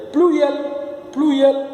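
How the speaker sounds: "Pluriel" pitched high and quick; high-pitched model repetition. Shown as a demonstration. Teacher shows learners it's easy, high quick repetition. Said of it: "Você repete comigo, é muito fácil".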